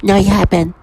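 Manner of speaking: mild mock of childlike whine; childish insisting. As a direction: mimic a childish voice, lightly. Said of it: "And that's how they sound".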